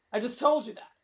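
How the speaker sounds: ordinary talk, nothing marked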